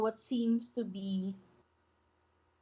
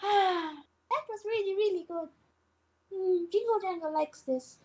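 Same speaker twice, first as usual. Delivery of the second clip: high satisfied goblin; 9 semitones higher than usual. Acted. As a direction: pitch up, savoring like a goblin. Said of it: "She says".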